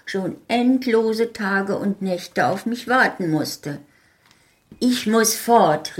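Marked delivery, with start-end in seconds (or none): 4.8-6.0 higher, louder, slow urgent cry; urgent exclamation; exclaiming. Acted. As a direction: burst out with sudden urgency. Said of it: "rief ich aus".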